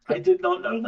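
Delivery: can't believe the news; stunned surprise. Real, not acted. Voice actor hearing he won the award, high and loud in shock. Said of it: "Oh my God".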